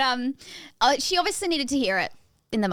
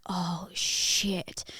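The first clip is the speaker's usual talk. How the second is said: breathy retold whisper, low and soft; whispered oh shit; 4 semitones lower, 5 dB quieter than usual. Medium recalling seeing a ghost mid-massage, hushed whisper. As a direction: hush it as if spotting a ghost. Sudden figure at massage bed.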